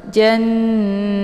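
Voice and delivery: Janna with ja pressed and the double n hummed for two beats; recited Janna with a held nasal hum; gunnah on janna. Shown as a demonstration. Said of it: "ja ditekan, kemudian ditahan suaranya dua harokat".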